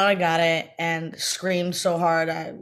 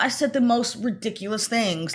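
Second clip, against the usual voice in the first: normal talking voice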